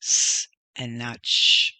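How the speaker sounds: long hissed "s" set against long "sh"; S versus SH. Shown as a demonstration. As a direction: hold s, then contrast it with sh. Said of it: "be pronounced".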